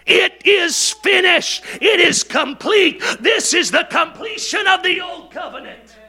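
shouted proclamation. Acted. Pastor echoing the cross to the church, triumphant shout. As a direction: fling it out high and loud, triumphant.

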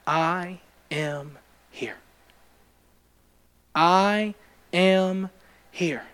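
soft, slow and low, barely above a breath; gentle, unhurried reassurance, hushed; still small voice. Retold or imitated. God answering a troubled man in prayer, hushed. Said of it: "It was just that still small voice".

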